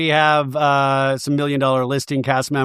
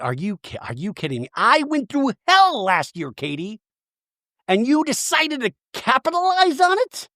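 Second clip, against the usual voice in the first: flustered; 8 semitones higher than usual. Retold or imitated. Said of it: "Shannon's just flustered".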